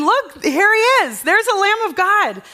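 high, loud excited cry; exclaiming. Acted. John the Baptist exclaiming to his disciples, excited. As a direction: call out in joyful recognition. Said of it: "he exclaimed".

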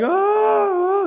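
loud, high drawn-out wail; mock wailing. Mourners following Mary to Jesus, loud mock wailing. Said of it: "all the wailing".

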